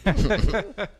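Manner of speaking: laughing. Real, not acted. Laughing after voice crack.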